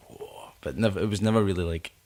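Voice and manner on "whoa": whispered mock roar; very soft, airy exclamation; hushed whoa. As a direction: whisper it like a distant crowd cheer. Recalls high school taste for metal.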